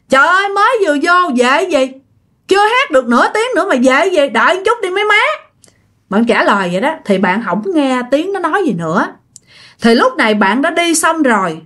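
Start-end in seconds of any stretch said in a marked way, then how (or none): 0.1-5.2 high, quick protest to friends; annoyed reply. Acted. Woman in the toilet answering friends who want to leave, annoyed reply. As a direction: fire back a mildly put-out answer. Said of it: "bạn mới trả lời vậy nè".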